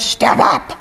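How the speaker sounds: rough, rasping parrot squawk imitation; deep gravelly yell. Lady the bird yelling back at Maureen, gravelly. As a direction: croak it out like a bird mimicking orders. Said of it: "yelled at the top of her deep, gravelly voice".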